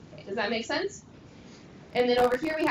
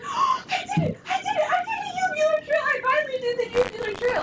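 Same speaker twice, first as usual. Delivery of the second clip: excited shout; 5 dB louder than usual. Retold or imitated. Excited shout in van retold.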